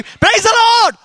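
high-pitched, delighted church greeting; exuberant greeting. Retold or imitated. Usually gloomy auntie surprises the preacher with a greeting, exuberant. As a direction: burst out with sudden joyful praise. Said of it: "I was shocked. What happened?".

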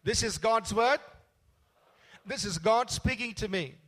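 bold, strong and loud, the whole church together; a pastor leading a loud congregational confession; loud bold declaration. Real, not acted. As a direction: proclaim it out loud with conviction. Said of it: "Let's say this out loud, bold and strong together".

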